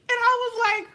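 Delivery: high, loud and worked up; already squealing before the quote; rising excitement. Real, not acted. Shocked at the news.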